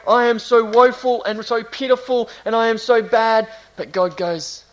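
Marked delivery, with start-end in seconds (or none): 0.1-3.5 high, loud mock lament; mock crying out. Preacher imitating someone crying out to God, mock woeful. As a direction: act out a self-pitying complaint. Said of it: "You can cry out and go".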